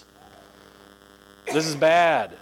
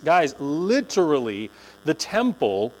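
normal talking voice

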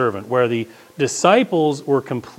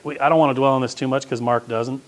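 normal talking voice

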